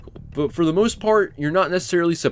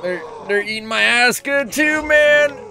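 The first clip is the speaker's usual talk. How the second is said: real gamer frustration; getting overwhelmed, shouting higher; exasperated yell; 11 semitones higher, 3 dB louder than usual. Real, not acted. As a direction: voice climbing higher into a yelp of frustration. Enemies swarming him in game.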